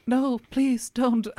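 high, drawn-out feeble begging; mock pleading. Wounded villain begging the gunman, high mock pleading. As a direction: imitate a wounded man pleading, very slowly. Shot man begs, retold.

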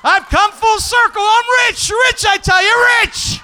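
high, shouted victory crow; triumphant bragging. Pretend. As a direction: belt it out like a jackpot winner. Bragging onstage about winnings.

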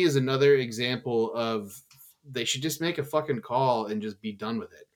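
ordinary talk, nothing marked

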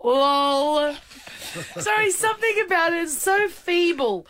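high-pitched talk through giggles; real giggly storytelling; laughing. Real, not acted. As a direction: keep talking while cracking up. Retelling while laughing.

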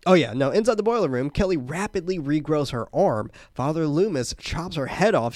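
ordinary delivery

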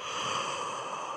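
long breathy yawn; big pretend yawn. Shown as a demonstration. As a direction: open wide and yawn. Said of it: "Big yawn".

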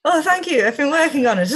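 high, loud Scottish accent with a laugh; Scottish lilt, pitched right up, amused. Shown as a demonstration. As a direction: proudly show off a Scottish accent. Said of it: "you do an excellent Scottish accent".

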